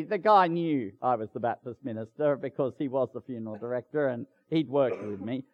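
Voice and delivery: ordinary delivery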